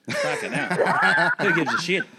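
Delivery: high, drawn-out laughing cry; laughing squeal. Real, not acted. Laughing outburst at wrong answer.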